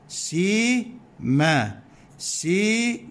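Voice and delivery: ما سي split into drawn-out syllables, the sin with i; spelled out slowly. Shown as a demonstration. Teacher spelling out the syllables to the students, very slowly. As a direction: sound out ما سي piece by piece. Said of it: "السين مكسورة".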